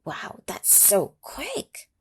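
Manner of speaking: plain reading voice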